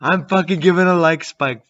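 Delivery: yelling at the camera, hyped and loud. Real, not acted. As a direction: shout it to the viewers. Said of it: "Why is he yelling?".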